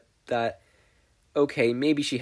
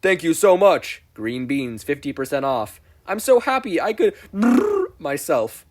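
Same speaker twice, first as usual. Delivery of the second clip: high, loud huckster pitch; glitchy pitchman announcing himself; Spamton salesman voice; 5 semitones higher, 9 dB louder than usual. Acted.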